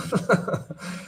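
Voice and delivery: short high breathy laugh; chuckle. Real, not acted. Trader amused by "has been strong", quick chuckle. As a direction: chuckle briefly at your own wording. Laughs at own clumsy wording.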